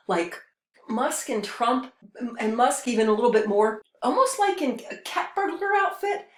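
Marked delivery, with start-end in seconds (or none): none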